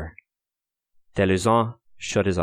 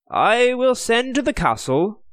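much higher, louder, smooth promise; false promise; 4 dB louder than usual. Acted. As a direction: sweet-talk the prisoner with a lie. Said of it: "promised Henry Hovenden".

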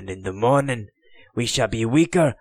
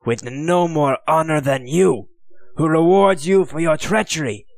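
scornful taunt rising higher and louder; jeering; 5 semitones higher, 3 dB louder than usual. Acted. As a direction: spit contempt at the queen and her men. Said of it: "he jeered".

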